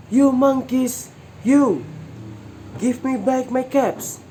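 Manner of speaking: he's losing patience, let it rip; angry peddler. A narrator's reading. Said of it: "This made the peddler angry".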